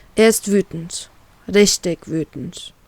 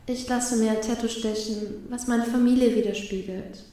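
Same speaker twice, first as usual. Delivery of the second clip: much softer, dreamy inner voice; dreamy thought; 9 dB quieter than usual. A narrator's reading. Woman dreamily imagines her family tattoo, much softer. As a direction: drift off dreamily. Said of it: "denke ich verträumt".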